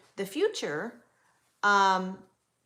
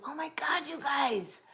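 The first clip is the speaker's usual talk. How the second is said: breathless whispered disbelief; excited whisper; 6 semitones higher than usual. Real, not acted. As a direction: whisper it like a delighted secret. Whispered excitement at another psych major.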